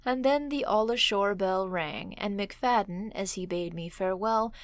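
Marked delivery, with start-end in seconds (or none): none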